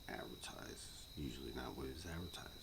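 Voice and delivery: usual speaking voice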